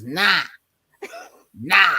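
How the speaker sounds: put-on a rough try at a Jamaican accent; drawn-out Jamaican nah. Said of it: "let me see if I can get, I can get a Yankee accent".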